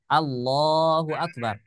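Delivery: "Allahu Akbar" slow with the la of "Allahu" stretched; lengthened Allah. Shown as a demonstration. Teacher showing the natural long vowel in Allah, slow. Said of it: "kalimat Allah ... kita baca mat tabi".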